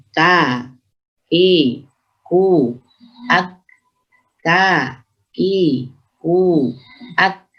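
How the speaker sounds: "K, ki, ku, ak" as separate, thin k syllables; "ki, ku" measured, with forward aspirated k; hams kaf drill. Shown as a demonstration.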